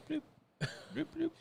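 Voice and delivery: small, soft bloop noises; imitated bloop. Showing how frogs reproduce, soft bloop sounds. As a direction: make quiet little popping bloops. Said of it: "They just kinda go".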